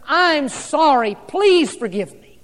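a heartfelt apology, drawn out and deliberate; slow sincere apology. Acted. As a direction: plead for forgiveness, slow and earnest. Pastor voices a husband apologizing.

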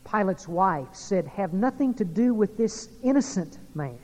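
loud, slow pulpit proclamation; booming sermon; preaching at full force. Acted. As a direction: proclaim each witness to the church. Preacher lists witnesses loudly.

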